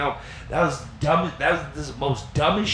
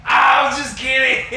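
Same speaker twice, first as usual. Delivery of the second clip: higher, louder and slow "just kidding"; sheepish loud excuse; 8 semitones higher, 5 dB louder, slower than usual. Retold or imitated. Caught in the kitchen, a loud sheepish excuse.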